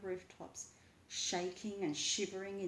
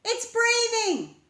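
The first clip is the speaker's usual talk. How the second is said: much higher, much louder, slow calling out; excited call; 16 dB louder, slower than usual. A narrator's reading. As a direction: shout the discovery. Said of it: "she called".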